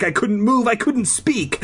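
high, rising panicked narration; hammed-up helpless fright; acted panic. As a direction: pitch it up in mounting dread.

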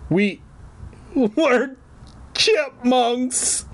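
high squeaky stammer. A narrator's reading. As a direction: squeak it out, halting. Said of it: "Fergie squeaked".

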